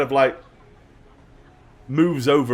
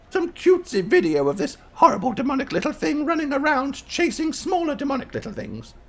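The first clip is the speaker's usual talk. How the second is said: high-pitched grandmother voice; puzzled grandmother; 13 semitones higher than usual. Acted. Grandma describing some cutesy video to a visitor, puzzled.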